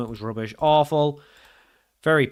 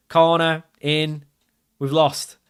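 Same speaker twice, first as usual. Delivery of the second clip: ordinary talk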